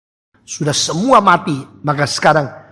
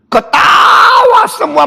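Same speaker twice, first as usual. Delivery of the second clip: slow booming exclamation; 5 dB louder, slower than usual. Acted. Gods laughing, heavens shaking.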